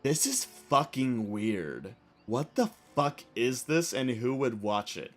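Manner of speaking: a low, disdainful imitation of the concertgoer; judgy guy. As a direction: sneer it low under your breath. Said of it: "And he goes".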